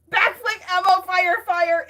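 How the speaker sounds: loud, high burst coming down in pitch; sudden shout. Real, not acted. Streamer breaking off to call the catchphrase, sudden loud shout. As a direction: yell it out abruptly.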